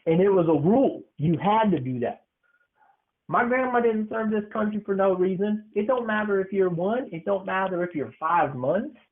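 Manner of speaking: usual speaking voice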